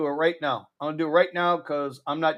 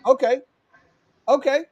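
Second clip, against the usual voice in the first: exasperated; 9 semitones higher, 6 dB louder than usual. Real, not acted. Exasperated at being cussed out.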